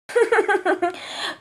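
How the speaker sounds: high mocking bird laugh; bird laughter. A narrator's reading. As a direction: cackle like a flock overhead. Contestan los pájaros desde el aire.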